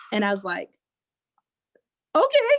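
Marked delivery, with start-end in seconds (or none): 2.2-2.6 high, loud gasp of an okay; a startled high okay; stunned okay. Retold or imitated. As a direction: squeak it out in disbelief. Stunned reaction to the voice.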